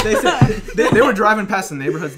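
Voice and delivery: laughing through the words. Real, not acted. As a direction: talk while cracking up. Laughing retelling.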